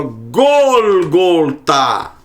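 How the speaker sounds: Golgotá drawn out slowly, syllable by syllable; slow Hebrew reading. Shown as a demonstration. Teacher reads Golgotá to the class, slowly. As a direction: spell out Golgotá slowly, each syllable clear. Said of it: "É assim que está nos escritos nazarenos em hebraico".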